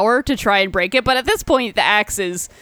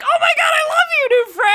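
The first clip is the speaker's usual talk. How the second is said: very high, loud, gushing squeal; overjoyed axe; 3 dB louder than usual. Retold or imitated. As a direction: gush like an eager talking weapon. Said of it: "he's like".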